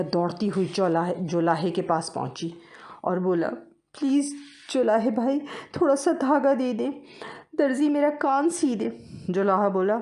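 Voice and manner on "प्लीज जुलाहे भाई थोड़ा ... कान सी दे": little mouse begging for thread. Acted. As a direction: plead with the weaver, high. Mouse begs weaver.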